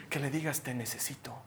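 very soft, low admission; humble plea. Acted. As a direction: let it drop to a hushed confession. (súplica humilde, muy bajito y grave)

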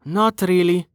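mild sigh in a lifted voice; sighed reply. Acted. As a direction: reluctantly turn it down. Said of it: "Amelia sighed".

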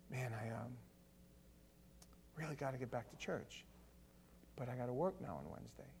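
low, soft, hesitant musing; musing to oneself. Acted. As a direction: think aloud quietly with little energy. Imagined churchgoer musing midweek to himself.

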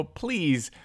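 ordinary talk, nothing marked

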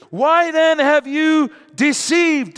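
high, loud, slow accusation; furious demand. Acted. Jacob demanding answers from Laban, furious. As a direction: shout it in outrage. First asking, shouted.

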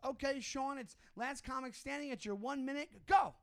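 mock radio host urging him on; pushy host. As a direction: rush him into his bit like a pushy host. Host pushing him to do bits.

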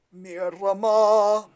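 slow, loud, drawn-out taunt; mocking. Podcaster as the judge making fun of his rival, drawn-out mocking. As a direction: stretch the word out to make fun. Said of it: "making fun of Zapato again".

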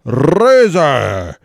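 loud, drawn-out wrestling ring announcer. Pretend.

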